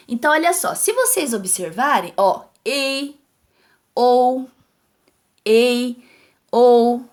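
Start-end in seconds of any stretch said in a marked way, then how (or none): 2.6-7.0 "ei", "ou" each sounded alone, held level; loud, separate glides on ei and ou; isolated vowel pairs. Shown as a demonstration. Teacher picking out vowel pairs from the rhyme, held sounds. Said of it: "são de encontros vocálicos".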